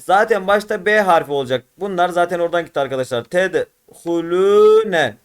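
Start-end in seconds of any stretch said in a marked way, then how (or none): 3.2-5.2 very slow Tedhulüne with lü held long; Tedhulüne in slow syllables, long lü; elongated reading. Shown as a demonstration. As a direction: stretch lü in Tedhulüne. Teacher models elongated Arabic reading.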